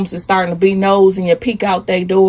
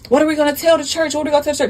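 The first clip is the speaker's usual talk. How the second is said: higher-pitched, hurried question; acted panicked worry; 6 semitones higher, much faster than usual. Husband worrying to his wife about church, panicked. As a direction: fret aloud, quickly repeating it.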